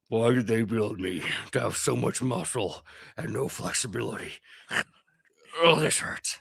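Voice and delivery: a lightly voiced brute, complaining about stiff muscles; groaning flesh construct. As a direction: grumble like a huge creature with tight hamstrings. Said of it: "you can hear him go".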